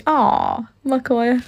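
normal talking voice

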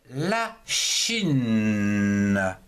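Chine held very long; drawn-out word. Shown as a demonstration. Teacher introducing la Chine as an example, drawn out. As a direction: stretch Chine slowly, lingering right through to the n.

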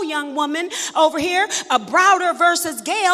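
loud, rhythmic preaching with shouted peaks; full-force preaching. Acted. Preacher to the congregation about her prayers, loud rhythmic preaching. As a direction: hammer it home to the congregation.

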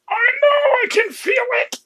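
very high, loud yell of a wife in labor; hammed-up shrieking retort; exasperated screech. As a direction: screech it through the pain. Acting wife snapping during labor.

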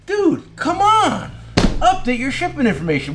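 pleading at customers who never update their address, high-pitched and exasperated; exasperated plea. Retold or imitated. Shop owner pleading with customers to update their address, high and exasperated.

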